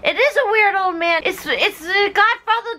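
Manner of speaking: put-on high, rising girl's cry calling to the clock; high girlish calling. Clara calling to the godfather on the clock, in a high girlish cry.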